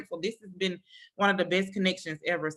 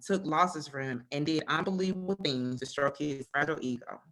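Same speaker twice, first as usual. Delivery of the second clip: lower, steady reading voice; reading a book excerpt; 5 semitones lower than usual. Acted. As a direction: read the passage to listeners. Reads a book quote aloud.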